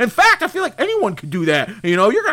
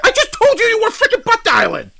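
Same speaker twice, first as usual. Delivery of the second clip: higher and louder, fed up; exasperated; 9 semitones higher, 3 dB louder than usual. Real, not acted. Host snapping at his confused guest, exasperated. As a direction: lose patience with him.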